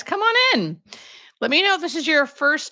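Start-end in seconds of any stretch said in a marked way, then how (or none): 0.0-0.8 lilting high invitation to join; sing-song welcome. Real, not acted. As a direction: come on in, swooping way up high. Welcoming viewers into the livestream.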